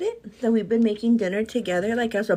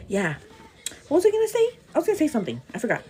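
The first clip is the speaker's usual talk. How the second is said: usual speaking voice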